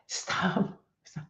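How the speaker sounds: unvoiced, soft mock scream; the scream only in your head; whispered inner scream. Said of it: "I'm screaming in my brain".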